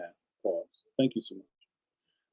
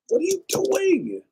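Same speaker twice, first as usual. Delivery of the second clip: mock disbelief.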